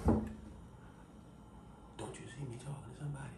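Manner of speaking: very hushed, stern whisper. Retold or imitated. Grandmother scolding a child in church, whispering. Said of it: "whispered in your ear and said this".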